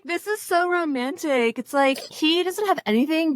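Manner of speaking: high, drawn-out romantic swoon; mock lovestruck gushing. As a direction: gush like you're hopelessly smitten, tongue in cheek. Imagined lovestruck girlfriend, mocking.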